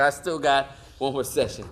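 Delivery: ordinary delivery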